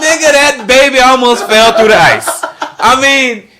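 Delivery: laughing while talking. Real, not acted. Mocking the actress's slip, laughing loudly throughout. Joking about the accent slip.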